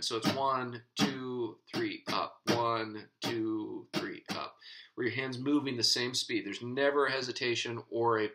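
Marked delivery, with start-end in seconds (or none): 0.3-4.6 smooth even counting; even steady count. Shown as a demonstration. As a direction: keep every beat and "up" evenly spaced. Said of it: "you want it to be even".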